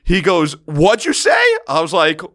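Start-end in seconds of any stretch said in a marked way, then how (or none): 0.7-1.4 high-pitched, sharp retold question; mock challenge. Frat brother turning on him at once, acted challenge. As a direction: confront as if daring a repeat.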